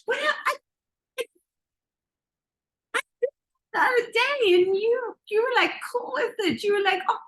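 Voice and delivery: put-on high, halting little-kid protest; bewildered child. Child answering a scolding mom, hurt and baffled. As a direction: plead like a confused, wounded child.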